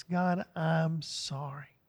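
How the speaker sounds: slow, soft, contrite sorry; a heartfelt confession, slowed and hushed; broken, sincere apology. Acted. Preacher voicing a repentant believer, slow soft contrite apology. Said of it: "it's gotta be brokenness".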